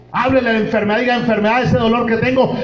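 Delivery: high, loud pulpit preaching; fiery sermon voice, pitched high; full-force preaching. Real, not acted. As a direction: preach it out to the congregation. Declaring healing, high and loud.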